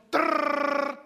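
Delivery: high rattling trill like a jackhammer; woodpecker impression. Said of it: "After I do my woodpecker impression".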